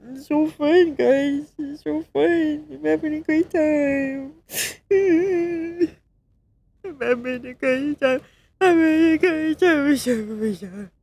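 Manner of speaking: sobbing sarcastic good time; real crying. Real, not acted. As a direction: choke out the cheerful words through real tears. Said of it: "Yeah, I'm crying 'cause it's fun".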